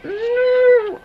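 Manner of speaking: very high, loud wailing no; terrified cry. Real, not acted. Video's worst moment, a high terrified wail of no. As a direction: cry out in real terror.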